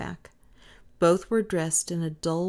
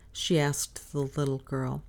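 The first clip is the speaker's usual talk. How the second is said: ordinary delivery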